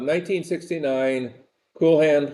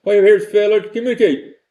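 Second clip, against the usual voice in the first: a light Southern accent imitation, higher and quicker; Southern drawl; 5 semitones higher, 3 dB louder, faster than usual. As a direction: try the Southern captain's line, pitched up. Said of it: "he said it with Southern drawl".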